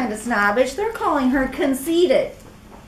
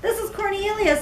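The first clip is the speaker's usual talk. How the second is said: raised, eager little caterpillar; Cornelius voice; 9 semitones higher than usual. Acted. Caterpillar coaxing his friend in a raised voice. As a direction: call her out of the egg, higher voice. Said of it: "he said".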